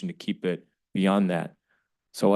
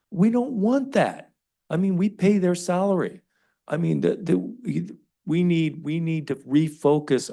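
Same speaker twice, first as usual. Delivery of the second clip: raised, high-pitched heated protest; indignant objection; 10 semitones higher, 4 dB louder than usual. Real, not acted. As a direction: object forcefully, voice lifted high and loud. Heated objection in council debate.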